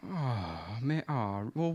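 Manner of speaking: low, soft imitation of disappointment; let down. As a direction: deflate like a disappointed fan. Acting out the let-down fan.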